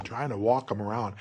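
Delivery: ordinary talk, nothing marked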